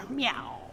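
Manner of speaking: soft little kitten meow. Shown as a demonstration. Reading to children, a soft joking meow. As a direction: meow like a house cat. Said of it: "what does a jaguar say?".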